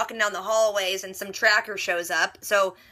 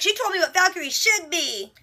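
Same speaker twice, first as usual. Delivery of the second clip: indignant and mouthy; 7 semitones higher than usual. Retold or imitated. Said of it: "gets all indignant and mouthy".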